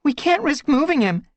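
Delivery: high, loud urgent warning. Acted. Leafpaw warning the others about moving Firestar, urgent. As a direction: warn them off sharply. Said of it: "Leafpaw warned".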